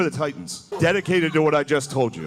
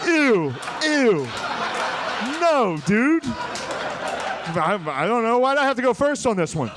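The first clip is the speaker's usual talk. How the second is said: higher, grossed-out protest; disgusted congressmen; 8 semitones higher, slower than usual. Pretend. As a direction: recoil in disgust, laughing. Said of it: "everyone's like".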